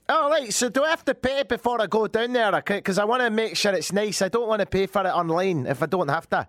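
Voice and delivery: high, fussy Scottish customer; put-on Scottish accent. Customer checking when to pay, put-on Scottish accent. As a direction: ask away in exaggerated Scottish. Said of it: "Scotland's good".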